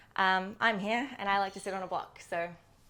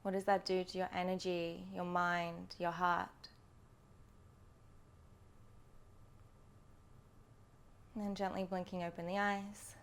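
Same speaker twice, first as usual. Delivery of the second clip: guided meditation. Acted. Yoga teacher settles students into breath before practice, guided meditation voice. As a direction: lead them gently inward, phrase by phrase, unhurried.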